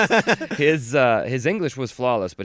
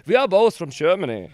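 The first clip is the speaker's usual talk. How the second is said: loud, higher mock-German voice; fake German accent; 8 semitones higher, 7 dB louder than usual. Jumping in before his German friend, fake German accent. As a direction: announce it in a put-on German accent. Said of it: "my German friend could say anything, I said".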